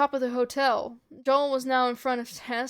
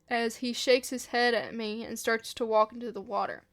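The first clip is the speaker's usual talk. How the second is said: usual speaking voice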